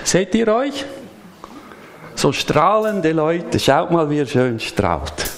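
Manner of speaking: ordinary talk, nothing marked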